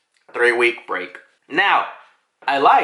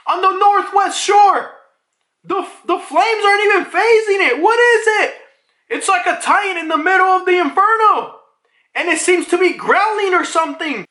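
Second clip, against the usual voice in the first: very high, alarmed mock shouting; high-pitched hammed-up terror; panicked radio report; 3 dB louder than usual. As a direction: imitate a frantic lookout reporting a monster. Frantic radio report of a titan.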